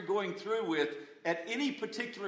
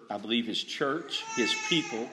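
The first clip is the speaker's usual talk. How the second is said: choked up; 6 semitones lower than usual. Real, not acted. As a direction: voice catching with real emotion. Preacher moved while affirming church.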